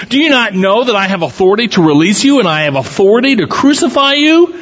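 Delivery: brisk, rising, incredulous demand; insistent questioning. A narrator's reading. Pilate to silent Jesus about his authority, insistent questioning. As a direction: press the question, climbing high at the end.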